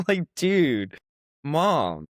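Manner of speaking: high-pitched, exasperated appeal to mom; retold exasperation. Daughter stung by mom's brush-off, retold exasperated protest.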